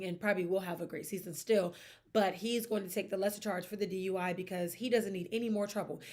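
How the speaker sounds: ordinary delivery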